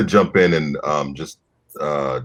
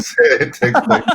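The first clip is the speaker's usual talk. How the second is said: laughing; 12 semitones higher, 6 dB louder than usual. Real, not acted. Laughs mid-sentence.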